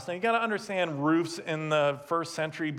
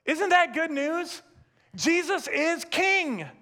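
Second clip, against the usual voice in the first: preaching at full force; 6 semitones higher than usual. Acted. Pastor to the congregation, rising in full sermon fervor. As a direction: proclaim it to the whole room.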